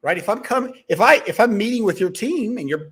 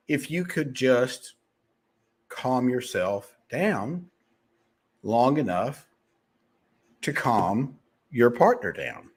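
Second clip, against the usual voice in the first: slow, lower and softer, calming; 6 semitones lower, 4 dB quieter, slower than usual. Shown as a demonstration. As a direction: talk them down slowly and gently. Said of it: "as I was speaking right there".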